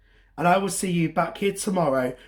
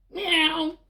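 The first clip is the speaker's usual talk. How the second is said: high, thin meow; cat meow; 11 semitones higher than usual. Acted. Storyteller voicing the park cat, playful meow. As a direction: mew like a little cat.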